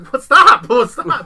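mock panicked yell. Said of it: "I'm like".

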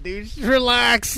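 exasperated mock plea. Mocking the uncle's cringe business posts, high loud and slow. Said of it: "I'm like".

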